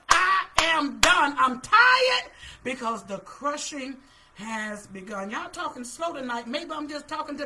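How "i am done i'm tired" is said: worn-out outburst. Acted. Preacher voices a worn-out believer.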